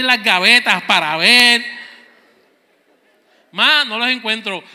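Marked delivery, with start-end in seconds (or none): 3.5-4.6 calling out. Retold or imitated. Hijo grita desde el cuarto.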